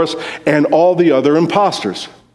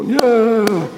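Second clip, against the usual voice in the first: high, drawn-out mock cry; crowd's reaction; 8 semitones higher, 4 dB quieter than usual. Preacher mimicking Minnesotans hearing about deer hunting, mock cry. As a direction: imitate a put-out crowd, higher than usual. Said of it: "people go".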